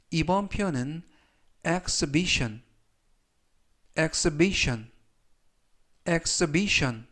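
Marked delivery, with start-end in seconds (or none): 1.7-7.1 exhibition said slowly with a silent h, eks-bi-shon; careful teacher's demo of exhibition, eks-bi-shon; model pronunciation. Shown as a demonstration. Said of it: "이라고 발음하시면 되겠고요". (exhibition을 또박또박 엑스비션으로 발음)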